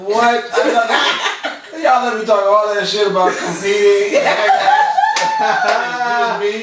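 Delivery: laughing loud and high in disbelief; cracking up at herself. Real, not acted. Realizes the competition was a trap.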